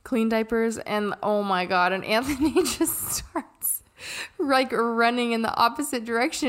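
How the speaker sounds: ordinary delivery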